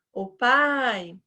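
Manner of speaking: drawn-out call for father; calling for dad. Acted. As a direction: stretch PAI long as a summons. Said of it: "desde pequena a gente chama".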